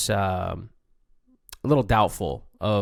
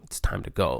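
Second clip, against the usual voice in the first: low, game-face declaration; understated warrior resolve, pitched well down; warrior mentality; 8 semitones lower than usual. Acted. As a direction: drop low, all business before battle. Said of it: "like, warrior mentality".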